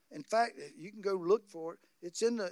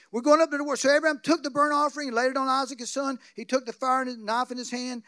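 louder sermon storytelling; preaching; 3 semitones higher, 5 dB louder than usual. Acted. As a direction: drive the story home from the pulpit.

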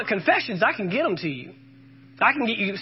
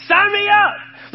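excited shout; 11 semitones higher, 7 dB louder, much slower than usual. Acted. Eager response to God's promise.

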